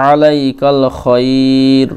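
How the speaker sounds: আলাইকাল খয়র drawn out slowly, final vowel dropped; pausal form. Shown as a demonstration. Teacher shows students how to stop on খয়র, slowly. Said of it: "থামেন, তাহলে এইভাবে পড়বেন".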